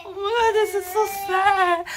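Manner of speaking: high, hammed-up whimpering; fake-cry in a high voice over the pet; high mock sob, laughing through it. Pet shut in, mock crying.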